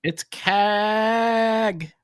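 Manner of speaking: high, held-out sing-song announcement; drawn-out greeting. Real, not acted. Cohost hailing a viewer who just joined, drawn-out greeting. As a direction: announce the arrival in a long, high call.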